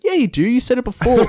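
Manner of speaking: very high-pitched indignant retort; mock whiny insistence; insistent protest. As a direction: argue back like an affronted drunk. Retold dispute, repeated protest.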